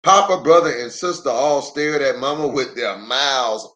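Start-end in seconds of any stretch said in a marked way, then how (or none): none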